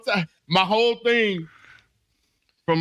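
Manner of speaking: ordinary talk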